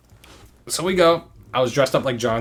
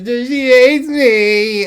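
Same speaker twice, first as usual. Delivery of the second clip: very high, very slow whiny wail; mock sobbing; 12 semitones higher, 9 dB louder, much slower than usual. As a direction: drag out the drunk whine. Imitating drunk crying.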